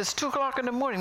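high-pitched exasperated parent, retold; retold yelling. Parent answering the kids at night, mock yell. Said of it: "And I yelled really".

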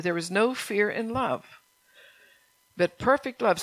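slow, measured Bible reading; scripture reading. Acted. Pastor reading the verse to his flock, measured. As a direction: read the verse with weight.

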